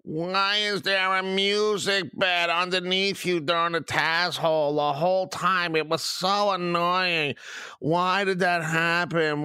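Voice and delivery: high, drawn-out whiny complainer; mocking whine. Host mimicking listeners who moaned about the music bed, mocking whine. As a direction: whine it slowly like a crybaby. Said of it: "cried, bitched, moaned, complained".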